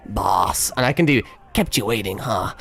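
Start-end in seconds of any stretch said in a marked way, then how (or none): 0.1-0.7 low game-hero voice; Snake. Acted. Streamer showing a character impression, low soldier voice. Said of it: "I can kind of do Snake". 1.5-2.5 lightly voiced game soldier, lower; Snake. Streamer showing a catchphrase, lightly lowered soldier voice. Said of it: "I can kind of do Snake".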